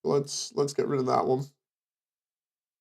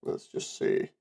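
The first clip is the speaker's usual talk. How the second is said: voiceless whispered aside; whispering; 11 dB quieter, slower than usual. Real, not acted. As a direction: whisper it to yourself.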